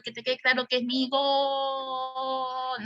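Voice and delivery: slow, drawn-out taunt to the rival; mock taunt at the rival team; slow taunting claim. Shown as a demonstration. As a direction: rub the goal in, slowly. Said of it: "sacarle cachita".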